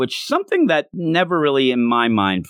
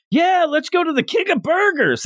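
high, bright fake enthusiasm; phony cheery voice. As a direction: play it like a fake, cheery mask. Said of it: "like, almost like phony".

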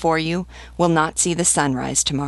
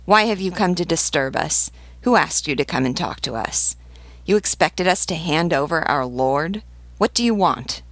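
ordinary delivery, nothing marked

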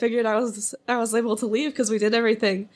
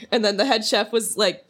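ordinary talk, nothing marked

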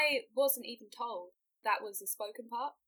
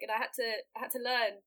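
ordinary delivery

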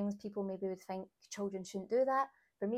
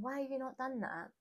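higher-pitched scolding, not actually shouted; mild mock rant; mock exasperated nagging; 5 semitones higher than usual. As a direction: imitate nagging a partner without raising the volume. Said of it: "or shouting at him across the room".

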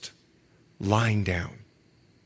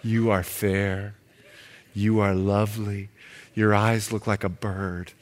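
mock tender lover; 9 semitones lower than usual. Preacher acting lovesick suitor.

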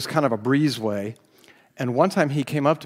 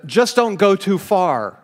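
higher, louder tempting ruler voice; a sermon voicing Pharaoh's tempting offer, louder and higher; 7 semitones higher, 7 dB louder than usual. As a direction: play the bargaining tyrant, pushing it out. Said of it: "Pharaoh said".

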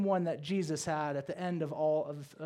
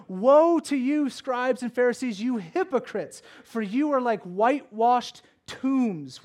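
loud, drawn-out, higher-pitched rebuke; preacher thundering a rebuke; stern denunciation; 5 semitones higher, 7 dB louder, slower than usual. Acted. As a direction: proclaim the woe slowly and forcefully. Quotes Jesus rebuking the Pharisees.

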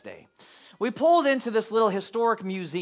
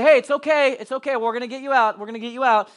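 calling through the door; 5 semitones higher, 5 dB louder than usual. Retold or imitated. Calling to daughters trapped inside.

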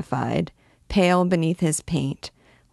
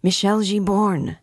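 understated narrated shout, pitched higher; mild shout; 4 semitones higher than usual. A narrator's reading. Impatient fellow calling almost in Jupiter's ear, mildly shouted. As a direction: call out with modest force, raised pitch. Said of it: "shouted almost in his ear".

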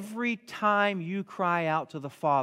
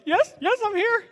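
much higher squeaky dog voice; eager dog voice; 5 dB louder than usual. Retold or imitated. Old Jack Russell answering her owner's call, high eager dog voice. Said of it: "She's like".

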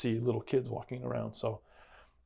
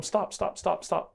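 yelling stop; 8 semitones higher, slower than usual. Retold or imitated. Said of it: "I tell him".

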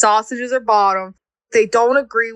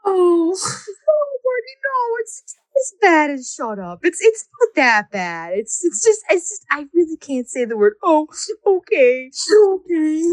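much higher, through laughing tears, soothing; high comforting voice; 9 semitones higher than usual. Retold or imitated. Aunt reassuring her crying cousin, high and soothing. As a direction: reassure the crying little boy. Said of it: "And I was like".

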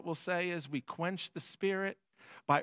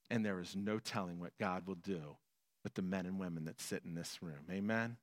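much softer, lower closing words; 9 semitones lower, 9 dB quieter than usual. Acted. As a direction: bring it down gently. Soft closing exhortation.

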